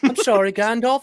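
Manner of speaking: high-pitched hammed-up apology; apologetic Bilbo. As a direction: play a cowed hobbit apologising.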